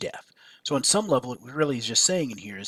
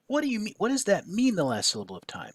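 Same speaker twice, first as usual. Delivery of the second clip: higher-pitched baffled question, settling at the end; confused questioning; 8 semitones higher, faster than usual. Acted. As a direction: act out a puzzled reader asking what it means. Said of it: "why this gets confusing".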